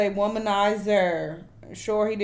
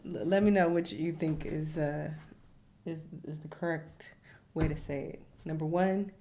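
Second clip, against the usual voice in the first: normal talking voice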